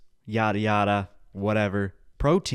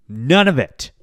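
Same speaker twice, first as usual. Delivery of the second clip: loud dismissal; 9 dB louder than usual. Real, not acted. Dismissing everything.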